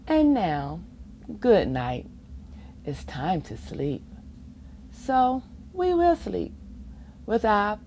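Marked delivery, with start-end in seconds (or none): none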